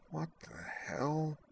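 slow, very soft, high-pitched groan. A narrator's reading. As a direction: groan it out slowly, half awake. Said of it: "she groaned".

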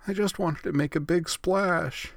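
high, slow whiny complaint; plaintive whine. Acted. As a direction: whine it like a sulky kid. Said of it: "Ritsu whined plaintively".

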